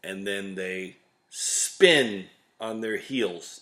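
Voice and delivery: normal talking voice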